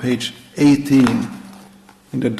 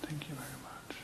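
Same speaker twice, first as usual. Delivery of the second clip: soft unvoiced thank-you; whispered thanks; 27 dB quieter than usual. Real, not acted. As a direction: whisper your thanks quietly. Quiet thanks before the puja.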